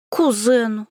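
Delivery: snorted mockingly; scoffing. A narrator's reading. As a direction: scoff at the word кузену. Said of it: "Варенька фыркнула". (насмешливо фыркнув)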